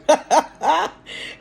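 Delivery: high, loud burst of laughter. Real, not acted. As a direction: laugh out loud at your own joke. Laughs at her own joke.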